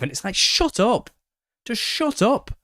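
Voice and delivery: high, loud shout of shut up; shrill mock-angry shut up; exasperated outburst. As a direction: snap at them like you've had enough. Exasperated rant at Gnostic commenters.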